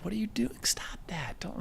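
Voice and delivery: urgent hushed whisper; embarrassed whisper. Retold or imitated. The embarrassed girlfriend to her boyfriend on the street, urgent whisper. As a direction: hiss at him to stop. Girlfriend hushing him in public.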